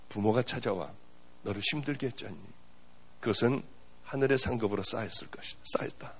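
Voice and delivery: hushed, low divine reassurance; voice of the Spirit. Acted. The Holy Spirit's reply voiced.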